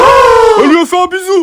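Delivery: loud high shocked cry; shocked gasp. Acted. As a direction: react in total shock. Shocked at kiss.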